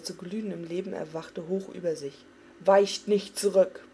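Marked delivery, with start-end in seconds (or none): 2.6-3.8 a slow, much louder roar; roared command. A narrator's reading. Arthas raising his hammer before his men, roaring. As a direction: hold the line with a bellow. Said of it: "brüllte er".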